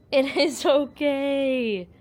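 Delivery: very slow, drawn-out soothing; emphatic reassurance. Real, not acted. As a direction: stretch each word to convince a scared pet. Soothing pet, drawn-out emphatic take.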